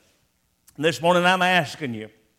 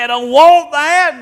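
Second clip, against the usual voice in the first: loud, much higher blurted rejection; mock refusal; 10 semitones higher, 12 dB louder than usual. Preacher acting a man who refuses, loud and high. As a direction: shout it like an offended refuser. Acted refusal in sermon example.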